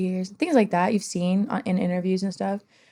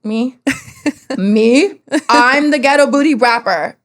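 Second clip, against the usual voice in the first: indignant outrage; 4 semitones higher, 6 dB louder, slower than usual. Real, not acted. Indignant at the label.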